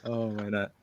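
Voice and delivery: ordinary delivery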